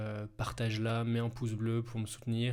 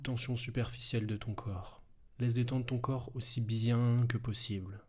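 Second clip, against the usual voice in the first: right in your ear. Acted. Hypnosis relaxation, close and soft.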